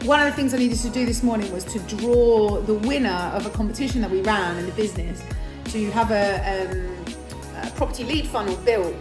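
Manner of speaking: usual speaking voice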